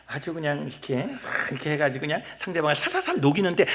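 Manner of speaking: softened, coaxing counsellor voice; gentle counselling manner. Shown as a demonstration. Pastor showing how he counsels, soft and coaxing. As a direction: melt the listener softly. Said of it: "상담할 때는".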